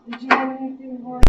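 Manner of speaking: ordinary talk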